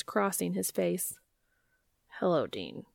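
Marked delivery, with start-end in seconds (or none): 2.2-2.8 low, shy deep-voiced hello; awkward greeting. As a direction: greet him stiffly and awkwardly. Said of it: "hand raised in an awkward wave".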